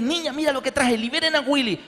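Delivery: eager, high-pitched announcement; proud father bringing home a treat; happy and excited dad. Retold or imitated. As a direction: show off the movie with delight. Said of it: "y llegué feliz".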